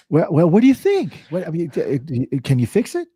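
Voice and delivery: high, loud anxious customer; mock customer. Customer asking the repairman about the dent, loud and high. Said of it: "the guy's like".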